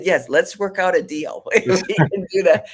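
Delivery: high, loud laughing bargain; patient bargaining with her doctor, high and laughing; laughing plea. Retold or imitated. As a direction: beg the doctor while laughing.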